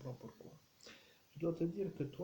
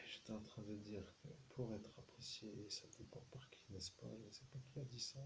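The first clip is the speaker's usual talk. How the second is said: hushed aside; 4 semitones lower, 8 dB quieter, faster than usual. Real, not acted. Medium going back over own words, hushed aside. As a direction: repeat it low and soft, nearly to yourself.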